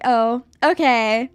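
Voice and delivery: slow, drawn-out judgmental reply; judgmental "oh, okay". Retold or imitated. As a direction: stretch it slowly, faintly disapproving. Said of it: "in, like, that judgmental way".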